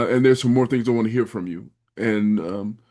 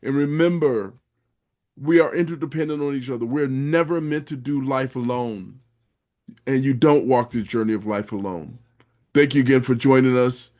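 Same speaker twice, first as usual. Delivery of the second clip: closing announcement to listeners; podcast outro; 4 semitones higher than usual. Acted. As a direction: wrap the show with warm announcer energy. Outro sign-off.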